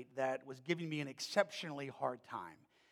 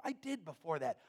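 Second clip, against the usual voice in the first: ordinary talk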